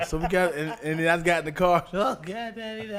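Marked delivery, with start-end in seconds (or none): 1.9-3.0 crying little daughter. Retold or imitated. Imitating crying daughter again.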